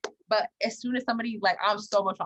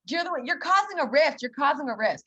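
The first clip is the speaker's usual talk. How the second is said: high-pitched mock accusation, mildly raised; retold yelling; 7 semitones higher, 4 dB louder than usual. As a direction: imitate people yelling blame at you. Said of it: "everybody yelled at me".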